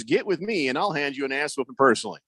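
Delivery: normal talking voice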